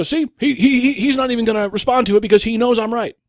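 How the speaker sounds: high, loud, fast crowing; hammed-up triumphant accusation, tumbling out quickly; mock gloating. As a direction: imitate an opponent claiming victory to the crowd. Acts accuser gloating at silence.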